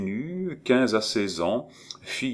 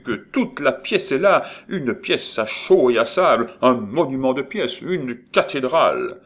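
loud, higher, swelling with conviction; exclaiming; 5 semitones higher, 6 dB louder than usual. Acted. Étienne crying out to Maurice about his play. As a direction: proclaim it like a grand vision. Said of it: "s'écria Étienne".

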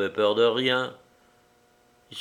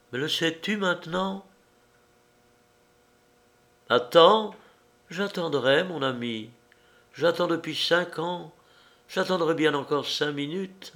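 higher, lightly voiced strange young woman; higher-voiced girl, eerily calm; woman's voice; 7 semitones higher than usual. A narrator's reading. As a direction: speak as the odd, intense girl. Woman character speaking.

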